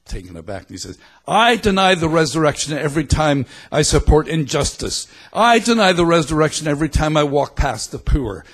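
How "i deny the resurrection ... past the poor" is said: put-on high, loud, emphatic Belfast accent; Pete Rollins. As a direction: declaim it forcefully like a Belfast orator. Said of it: "so there's the accent".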